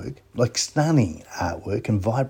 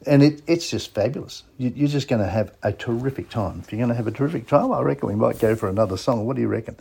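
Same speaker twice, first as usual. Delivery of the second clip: usual speaking voice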